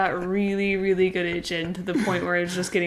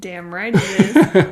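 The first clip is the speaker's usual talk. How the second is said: laughing; 10 dB louder, slower than usual. Real, not acted. Laughing triumph over twenty dollars.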